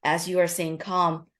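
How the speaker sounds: ordinary delivery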